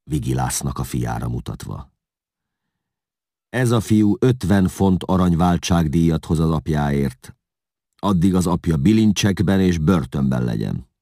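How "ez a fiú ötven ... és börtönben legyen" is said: lightly voiced ruler, higher and louder; commanding Attila. Attila sets ransom for the boy's father, lightly acted command. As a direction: lay down terms emphatically.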